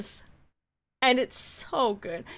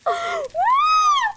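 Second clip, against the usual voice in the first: high screaming laugh, much higher and louder; shrieking laugh; 4 dB louder than usual. Real, not acted. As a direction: crack up into a shriek. Streamer cracking up at friend.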